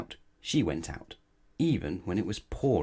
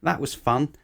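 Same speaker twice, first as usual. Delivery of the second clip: higher, louder fake brightness; forced cheer; 7 semitones higher, 5 dB louder than usual. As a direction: pretend it was fun. Pretends fall was fun.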